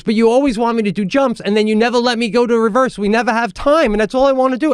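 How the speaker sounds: put-on very high, insistent little-kid plea; imitated protesting child. Ten-year-old daughter confronting her coach, imitated upset protest. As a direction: plead your case in a high child voice.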